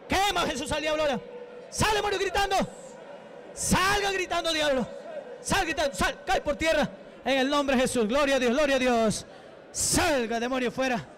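forceful, high-pitched deliverance commands; exorcism preaching. Real, not acted. Preacher to a demon he is casting out, commanding. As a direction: order the demon out in rapid, insistent bursts.

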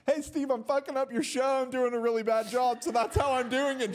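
high loud sing-song self-mockery; loud honest confession. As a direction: blurt it out loud to the showrunner. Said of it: "I literally just loudly".

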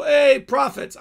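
mock-formal hello, a touch overdone; mock grand greeting. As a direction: greet them like a pompous title-lover. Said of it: "walk into her house and go".

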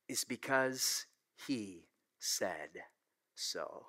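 sermon close, quiet and slow; hushed. Real, not acted. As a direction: drop to a near whisper on the last word. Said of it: "sermon climax drop".

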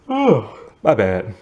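slow words through a yawn. Real, not acted. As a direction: say it mid-yawn, apologetic. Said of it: "I'm yawning".

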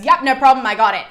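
loud, chirpy, eager-to-please reply; eager Iris. Retold or imitated. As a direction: snap to it like a keen helper. Eager messenger Iris answers.

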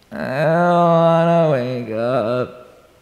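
put-on very slow, drowsy little girl dragging the words; groggy, barely awake protest; sleepy whine. Tired daughter to mom who wakes her, slow sleepy whine.

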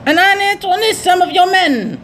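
high silly villain voice; hammed-up pirate captain. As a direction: proclaim it like a pompous pirate. Voicing pirate captain dialogue.

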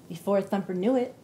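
usual speaking voice

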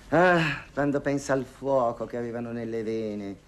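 ordinary talk, nothing marked

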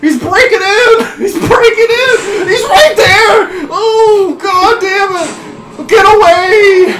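high, loud panic edged with nervous laughter; frightened screaming. Real, not acted. Player as monster breaks through the door, frightened screaming. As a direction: scream in mounting terror, stretching the words. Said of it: "I'm so fucking frightened right now".

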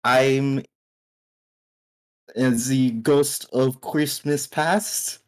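put-on slow, halting German accent; attempted German accent. Player trying out a joke on the scientist, tentative German accent. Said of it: "Is that a good German accent?".

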